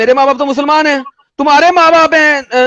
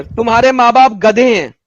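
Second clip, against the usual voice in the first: slow, drawn-out pronouncement; declaimed quote; 3 semitones lower than usual. Acted. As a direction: spell it out word by word, slowly. Quoting scripture at opponent, emphatically.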